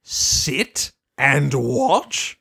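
low, slow, taunting echo; menacing Discord voice. Retold or imitated. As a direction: drag the words out like a looming villain. Discord mocks Spike's line.

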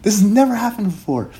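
high, breathless prophetic urgency; urgent Shauna. Acted. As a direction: spill the vision in a rush. Shauna tells of Saint Jessica.

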